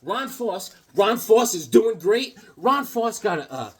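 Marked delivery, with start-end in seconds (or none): none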